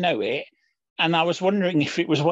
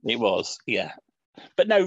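usual speaking voice

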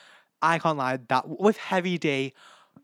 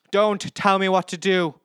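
"Don't tell me what to do" yelled higher and louder; loud, furious "Don't tell me what to do"; angry shout; 7 semitones higher, 8 dB louder than usual. Retold or imitated. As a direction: hit "tell" hard and loud. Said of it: "This is from Big Boss".

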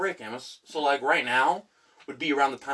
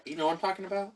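ordinary talk, nothing marked

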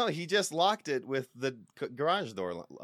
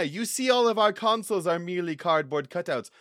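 usual speaking voice

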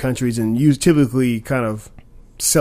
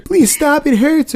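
high pleading whimper, much higher; begging for my life; 6 dB louder, slower than usual. Retold or imitated. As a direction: beg for mercy in a high, strained voice. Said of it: "them begging for their lives".

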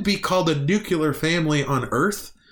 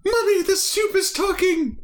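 put-on very high squealing child's scream; child terrified the soup talks; scared alien child. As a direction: shriek like a frightened little kid. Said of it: "she's like".

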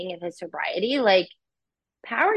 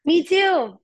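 ordinary talk, nothing marked

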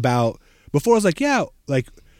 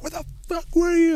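much higher, exasperated demand; mock angry friend. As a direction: play the furious host, lightly. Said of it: "so mad".

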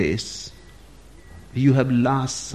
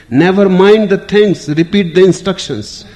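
higher, louder barked order; shouted command; 7 semitones higher, 6 dB louder than usual. Retold or imitated. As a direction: snap the order out in a mock rage. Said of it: "and shouted".

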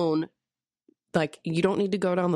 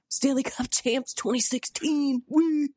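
high, drawn-out celebratory slogan; mock fan cheer; 7 semitones higher, slower than usual. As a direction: cheer it like a proud hockey fan. Mock cheers a bumper sticker slogan.

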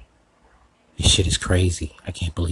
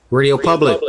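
loud call across the empty platform; 6 semitones higher, 6 dB louder than usual. Acted. Host calling out to Radio Public, loud call. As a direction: shout the name out to be heard.

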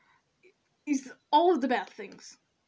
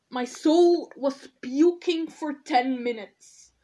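worked-up rant; 6 semitones higher, 5 dB louder than usual. Real, not acted. Worked up over Trump remarks.

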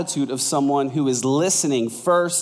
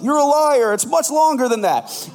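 mock heckler; 9 semitones higher than usual. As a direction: jeer it like a congregation member calling him out. Congregation heckling pastor.

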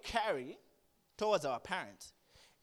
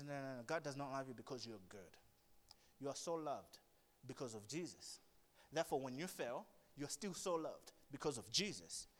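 gentle God voice; 6 semitones lower, 8 dB quieter than usual. Retold or imitated.

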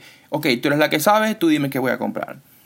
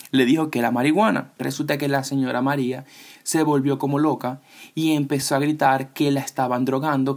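ordinary talk, nothing marked

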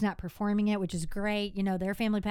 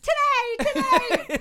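much higher and louder, frantic promise; flustered reassurance; 9 dB louder, much slower than usual. Retold or imitated. Rushed caller asks about the ad, she frantically promises today. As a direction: blurt it out high and loud, cornered.

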